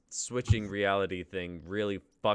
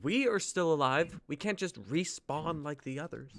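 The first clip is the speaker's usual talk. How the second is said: high, urgent protest; alarmed protest; 9 semitones higher, 3 dB louder than usual. Acted. Amy Lynn protesting to Natalie about dying, high and urgent. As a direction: push back, pitched high and worried.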